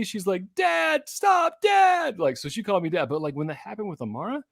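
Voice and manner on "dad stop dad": put-on high shouting toddler; high child shouting stop; toddler yelling dad. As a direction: yell for dad like a tantruming kid. Toddler tantrum carried out.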